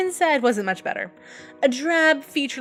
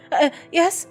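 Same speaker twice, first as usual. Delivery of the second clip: startled response; 6 semitones higher, 4 dB louder than usual. A narrator's reading. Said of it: "snapped to attention and responded".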